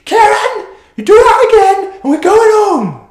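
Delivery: high, loud scream in put-on Welsh; mock-Welsh shriek at a misbehaving child; screaming Welsh mother. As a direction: yell the threat like an exasperated Welsh mum. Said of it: "she leant across me again and she screamed".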